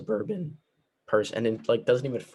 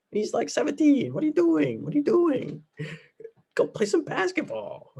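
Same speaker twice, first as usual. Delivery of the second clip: much higher, incredulous scolding; exasperated squeaky rant; 4 dB louder than usual. Acted. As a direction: scold the lovers in a mock squeaky voice. Ranting at the play's teen lovers.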